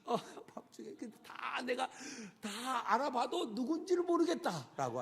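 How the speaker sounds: mild mock angry cursing; rising retold tirade. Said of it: "막 욕하면서".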